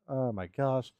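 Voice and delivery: ordinary talk, nothing marked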